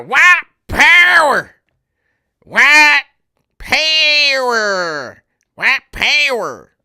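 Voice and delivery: very high, slow mock shout; Dave Chappelle character. Quoting the skit's blind Klan member, hammed-up yell. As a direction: holler with long stretched Southern vowels. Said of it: "I love how he says".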